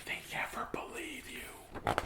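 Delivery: hushed whispered aside; spooky whisper. Acted. Reader confiding to the listener in a whisper. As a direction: whisper it like a secret.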